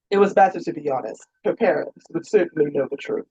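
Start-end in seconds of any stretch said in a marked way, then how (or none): none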